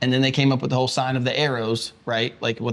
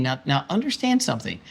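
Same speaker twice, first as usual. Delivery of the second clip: usual speaking voice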